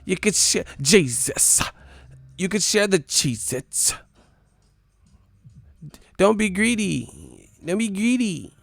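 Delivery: high, loud whiny pageant voice; mock pageant contestant. Mocking a pageant contestant's rambling answer, high and loud. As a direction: whine for snacks like a pageant girl.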